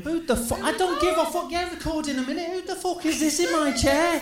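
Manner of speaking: high-pitched outraged shouting; scared and angry. Acted. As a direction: shriek it at the stranger in your chair. Outraged at man in chair.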